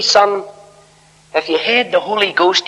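high-pitched Scottish imitation; little Scottish voice. Clergyman correcting a young preacher, little Scottish voice. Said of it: "in his little Scottish voice".

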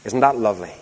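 usual speaking voice

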